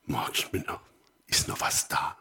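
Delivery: low, hushed southern German question; gentle southern German murmur; southern German dialect. Acted. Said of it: "wie der Süddeutsche sagt".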